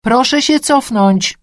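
slow, high, loud order; agitated appeal. A narrator's reading. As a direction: press the crowd back, nerves showing. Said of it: "ponownie zaapelował zdenerwowany mężczyzna".

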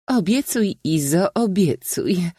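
bright high teasing laugh in the words. A narrator's reading. Księżna laughing at her friend's promise, teasing high voice. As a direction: tease him through a laugh. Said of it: "zaśmiała się księżna Idalia".

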